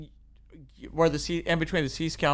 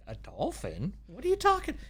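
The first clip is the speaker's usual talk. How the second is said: mock disbelief; 10 semitones higher than usual. Guide answering the girl who spotted a dolphin, retold disbelief. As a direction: act out baffled doubt, voice shooting up at the end.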